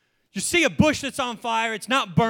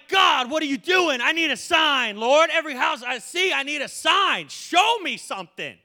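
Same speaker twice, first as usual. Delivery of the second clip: frustrated plea; 6 semitones higher, 3 dB louder than usual. Retold or imitated. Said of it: "I'm like".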